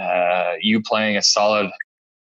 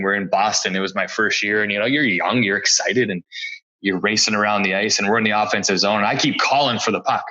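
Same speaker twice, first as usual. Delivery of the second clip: ordinary delivery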